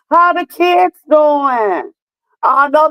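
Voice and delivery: high, drawn-out friendly question; gushing, high-pitched check-in; delighted calling out. Real, not acted. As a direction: sing it out slowly to a dear friend.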